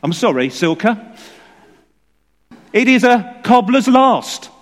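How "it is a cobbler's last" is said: high, loud, delighted punchline; spring the answer on them with glee; triumphant reveal. Real, not acted. Preacher springing the joke answer on the congregation, gleefully loud.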